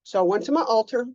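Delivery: normal talking voice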